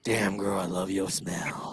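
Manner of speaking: low, slow creepy whisper. Acted. Mock pickup line.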